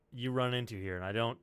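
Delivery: usual speaking voice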